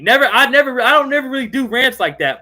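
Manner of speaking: loud, high, heated outburst; worked-up apology. Real, not acted. Streamer apologizing to chat after his rant, worked-up and loud. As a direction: blurt an apology while still fired up. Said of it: "I got a little too mad".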